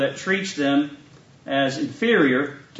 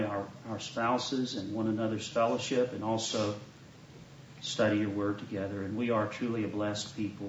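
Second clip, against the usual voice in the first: soft, hushed prayer voice; 4 semitones lower, 9 dB quieter than usual. Acted. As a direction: pray softly and reverently. Leads an opening prayer.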